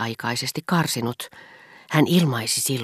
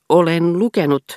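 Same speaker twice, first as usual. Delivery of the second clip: every syllable of "Olen lukenut" hit hard and louder; hammering each syllable; 5 semitones higher, 7 dB louder than usual. A narrator's reading. Scholar holding forth at dinner, hammering syllables. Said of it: "vasaroiden joka tavua".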